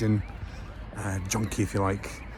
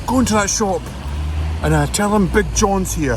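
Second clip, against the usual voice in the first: loud, high shouted instruction; stranger giving orders; 8 semitones higher, 8 dB louder than usual. Retold or imitated. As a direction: imitate a local hollering orders across the road. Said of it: "He goes".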